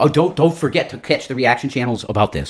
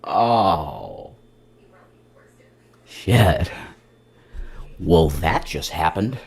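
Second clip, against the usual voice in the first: stunned; 5 semitones lower, much slower than usual. Retold or imitated. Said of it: "you're just like".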